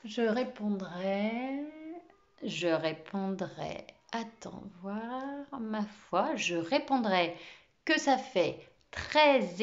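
very slow, stalling while calculating; slow deliberating. Acted. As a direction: drag it out, thinking as you go. Teacher works out sum aloud.

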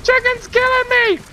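high-pitched, frantic yelps; mock panic. Gamer fleeing a killer chicken, high mock panic. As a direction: scream in playful terror, voice way up.

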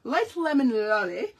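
light lemon lolly in a playful British accent; "Light lemon lolly" tried out in a mock British accent. Shown as a demonstration. Crafter trying the product name in a British accent. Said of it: "Try saying that ten times fast".

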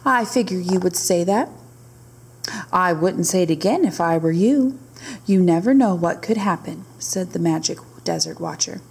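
lively storybook narration; reading to children. A narrator's reading. Teacher reading a camel story to her class, lively storybook read. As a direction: read it aloud to the little ones. Reads picture book aloud.

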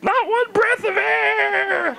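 put-on high, straining old-man rant; ranting granddad. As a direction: lecture the youngsters in a cranky high voice. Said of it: "I feel like your granddad".

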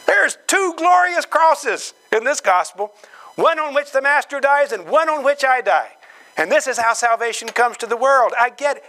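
high-pitched eager discovery; excited realization. Acted. Peter finally getting the gospel, excitedly explaining it. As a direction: blurt the insight out, pitch up high.

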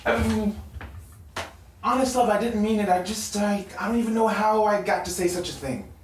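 high, loud, desperate sorry; frantic apology. Acted. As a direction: beg her forgiveness in a rush. Boyfriend frantically apologizing for insult.

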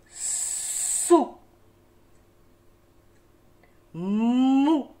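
each syllable from "Vous" to "Chou" with its first consonant held long; syllable sounding-out. Shown as a demonstration. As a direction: stretch the consonant, then slide into "ou", pausing between syllables. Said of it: "j'accorde avec la consonne devant".

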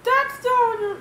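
high, loud approval; George cheering on. Acted. George approving Harold's defiance, high and loud. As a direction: back him up high and loud.

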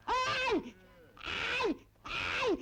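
high, sharp "ay" yelps; pained yelps. Acted. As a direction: yelp as if pinched. Pinched to prove she's awake. (çimdik yemiş gibi bağırma)

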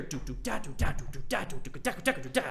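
crisp drum syllables, weak "do", strong "da"; clear accent pattern. Shown as a demonstration. Teacher showing both rhythms, clearly accented vocalised drumming. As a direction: tap out the rhythm by mouth, accents distinct. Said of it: "it should sound very clear".

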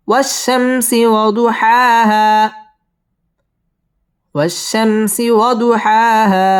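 slow, high tajweed demo; slow model recitation. Shown as a demonstration. Teacher modelling the verse for students, slow chant. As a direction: recite it slowly for students to copy. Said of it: "wow ঠোঁট গোল করে উচ্চারণ করতে হবে".

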